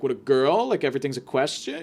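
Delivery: ordinary delivery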